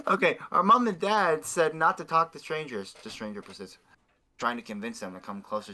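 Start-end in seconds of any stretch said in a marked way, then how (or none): 0.1-2.9 put-on child voice. Kid voice in read-aloud fanfic.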